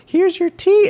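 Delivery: put-on very high, loud anime girl. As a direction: serve it in mock anime squeal. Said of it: "he's, like, voicing an anime girl".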